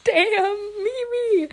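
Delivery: high excited cry of the name. Real, not acted. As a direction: squeal it out, laughing. Amazed outburst at Mimi.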